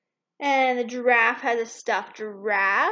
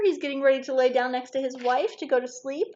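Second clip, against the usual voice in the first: usual speaking voice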